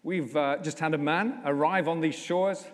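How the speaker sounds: ordinary talk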